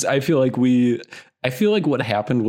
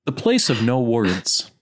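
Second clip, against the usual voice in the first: ordinary delivery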